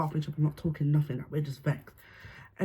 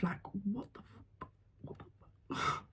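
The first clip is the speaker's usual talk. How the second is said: hushed disbelief; 3 semitones higher, 11 dB quieter, slower than usual. Retold or imitated. Recalling shock, voice drops away.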